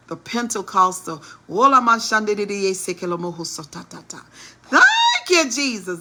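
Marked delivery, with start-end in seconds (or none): 1.5-4.2 rapid-fire imitation of praying in tongues; mock speaking in tongues. 4.6-6.0 high, loud burst of mock praise; mock church shout. Mocks churchy outburst.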